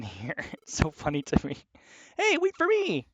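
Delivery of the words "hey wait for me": very high, plaintive character call; calling after someone. Acted. Character left behind at the door, calling out high. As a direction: cry out so as not to be left.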